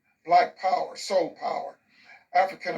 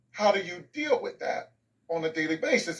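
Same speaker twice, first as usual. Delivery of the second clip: usual speaking voice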